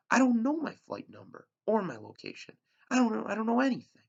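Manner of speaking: high, loud panicked rush; frantic, fast, near tears; desperate outburst. Acted. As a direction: spill it out fast in frustrated fear. Boy overwhelmed answering radio questions.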